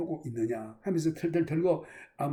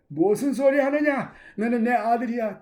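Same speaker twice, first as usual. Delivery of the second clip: father's loud protest; 5 semitones higher, 7 dB louder than usual. Acted. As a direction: brush off his apology with a loud cry. Father embracing returning son. (돌아온 아들에게 외치는 아버지의 큰 목소리)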